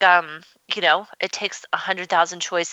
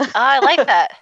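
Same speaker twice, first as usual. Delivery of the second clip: delighted; 7 semitones higher, 6 dB louder than usual. Real, not acted. Delighted reaction to host's metaphor.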